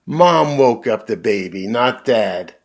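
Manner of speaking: ordinary delivery, nothing marked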